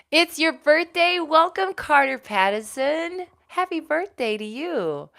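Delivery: high, loud, slow cheerful welcome; excited greeting. Real, not acted. Host welcomes birthday viewer, cheerful and high. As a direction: greet him like a party host.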